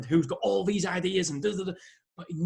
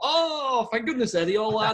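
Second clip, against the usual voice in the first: high, louder oh of relief; relieved delight; 5 semitones higher, 4 dB louder than usual. Retold or imitated. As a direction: glad to find the notes again. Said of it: "I thought".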